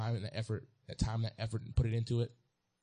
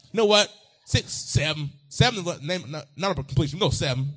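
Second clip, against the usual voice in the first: rising preacher excitement; 7 semitones higher, 8 dB louder than usual. Real, not acted. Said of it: "building on the number seven".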